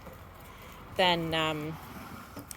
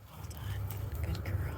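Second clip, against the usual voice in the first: soft whisper of praise to the dog; 3 semitones lower, 11 dB quieter, much slower than usual. Real, not acted. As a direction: hush the praise so she stays put. Praising dog during quiet stay.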